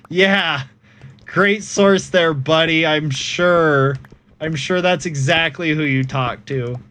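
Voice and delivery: mock the bogus source sarcastically; sarcastic. Sarcastic reply to imagined source.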